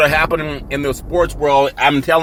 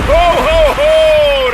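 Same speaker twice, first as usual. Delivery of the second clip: much higher, louder Santa ho ho ho; Santa laugh; 12 semitones higher, 4 dB louder, much slower than usual. Acted. Host plays Santa after singing [name], jolly ho ho ho. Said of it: "[name]".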